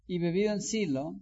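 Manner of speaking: ordinary delivery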